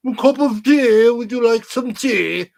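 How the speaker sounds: high, slow mock British accent. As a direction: put on a fluting British voice, drawn out. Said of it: "He talks with a British accent".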